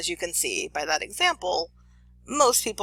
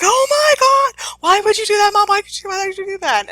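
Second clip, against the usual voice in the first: horrified shriek; 12 dB louder than usual. Retold or imitated. Girl shrieking at her mom after the toilet bite. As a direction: scream it at mom in disbelief. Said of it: "the girl's like".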